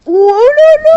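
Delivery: very high, loud squeal; excited squeal. Real, not acted. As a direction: shriek it with glee.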